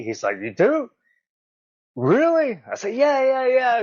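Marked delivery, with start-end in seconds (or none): 0.4-2.6 high-pitched imitation of amazed disbelief; retold surprise. Retelling Chris's amazed reply. 2.9-3.8 high, tumbling eager reply; retold eager answer. Retelling his own eager answer.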